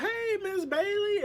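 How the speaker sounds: sing-song falsetto hello, stretched slow; high mock greeting. Nene greeting Cynthia on the float, high sugary falsetto. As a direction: sweetly fake hello, way up high. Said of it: "she's like".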